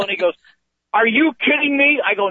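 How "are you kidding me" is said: high, amazed question; mock incredulous. Friend on the phone hearing about the pool, incredulous. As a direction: imitate a friend who can't believe it.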